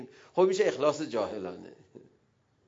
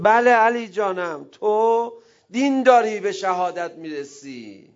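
slow, higher, tender reassurance; reassuring Prophet; 6 semitones higher, 6 dB louder, slower than usual. Pretend. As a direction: comfort a beloved one slowly.

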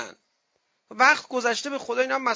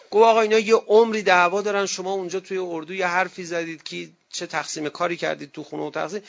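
normal talking voice

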